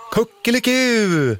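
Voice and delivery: high, slow mock crow by the kids; children's playful high cock-a-doodle-doo; children imitating a rooster. A narrator's reading. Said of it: "svarar alla barnen".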